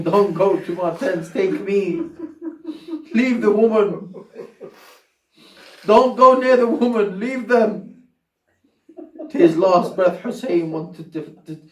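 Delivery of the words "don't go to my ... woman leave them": pleading cry. Acted. Hussein pleading with his killers.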